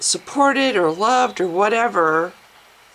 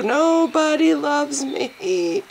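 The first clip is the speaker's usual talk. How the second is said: mock self-pity; 7 semitones higher, much slower than usual. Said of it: "convinced that it's true".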